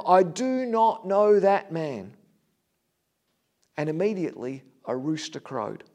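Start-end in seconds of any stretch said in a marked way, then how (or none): 0.0-2.2 slow, louder, emphatic retold denial; higher, slowed, insistent denial; sworn denial. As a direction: swear it word by word, raised voice. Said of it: "He began to curse and swear to them".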